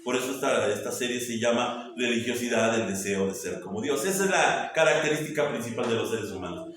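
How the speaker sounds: ordinary delivery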